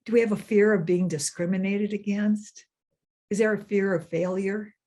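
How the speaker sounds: normal talking voice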